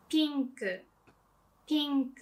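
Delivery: pink with a tense smiling i like ee; Japanese-style pink. Shown as a demonstration. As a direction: smile wide on the i of pink. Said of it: "In Japanese, you wanna say".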